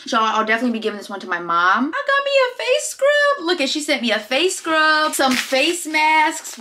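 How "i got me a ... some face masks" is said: high, drawn-out squeal of delight; excited squeal. Real, not acted. Vlogger unboxing friend's gifts, excited squeal. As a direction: squeal over a new present.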